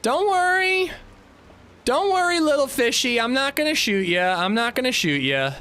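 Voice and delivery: put-on sing-song baby talk to a fish; high cutesy voice. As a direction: coax it high and sweet, like to a pet. Soothing prey over voice chat.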